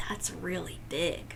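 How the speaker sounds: soft low whisper. Real, not acted. Reader whispers to kids about the tower, soft whisper. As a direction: hush it like a secret.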